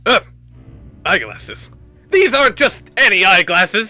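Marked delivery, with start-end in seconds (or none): 2.1-3.9 higher, louder bragging villain; boastful villain. Acted. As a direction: show off the goggles proudly. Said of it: "he says".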